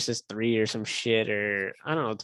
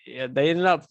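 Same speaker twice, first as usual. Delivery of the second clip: normal talking voice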